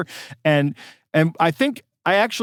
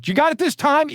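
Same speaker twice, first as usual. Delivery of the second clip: frustrated caller; 12 semitones higher than usual. Retold or imitated. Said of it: "you're really getting frustrated with me".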